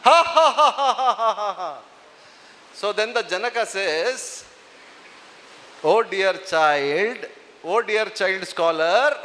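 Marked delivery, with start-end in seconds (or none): 0.0-1.8 loud laugh demo. Shown as a demonstration. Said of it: "Come on, show me". 5.8-9.2 gentle king. Retold or imitated. King addresses boy, retold.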